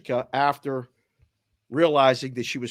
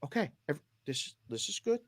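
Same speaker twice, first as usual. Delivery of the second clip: soothing calm; 10 dB quieter than usual. Retold or imitated.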